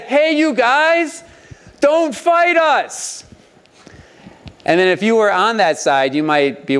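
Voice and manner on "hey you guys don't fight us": slow, high called-out shout; mock shout across. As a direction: call it across the valley, drawn out. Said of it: "he shouted over to the other mountain".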